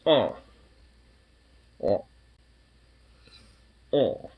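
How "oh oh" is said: usual speaking voice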